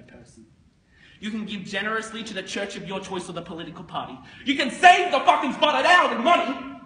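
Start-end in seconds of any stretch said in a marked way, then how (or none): 4.4-6.8 high, very loud boast; exultant shout. Acted. Broker extols what money can do, shouting high. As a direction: blast it out at the top of the boast.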